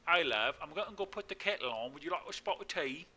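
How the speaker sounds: cheerfully awful British impersonation; terrible British accent. Acted. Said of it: "my best British terrible accent".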